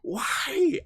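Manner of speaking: high, pleading cry of why; begging Hegel like a betrayed detective; mock anguished pleading; anguished plea. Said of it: "I'm like".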